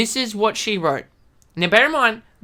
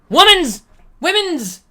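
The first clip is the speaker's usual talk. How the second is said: loud outburst; 9 semitones higher, 7 dB louder than usual. Real, not acted.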